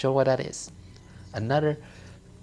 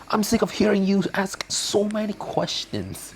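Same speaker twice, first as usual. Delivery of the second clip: exasperated husband; 8 semitones higher than usual. Acted. Husband scolding wife.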